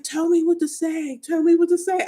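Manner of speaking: high-pitched imitated helplessness; confused pleading. Doctor unsure how to comfort her, confused pleading. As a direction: plead like someone at a loss. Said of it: "so confused".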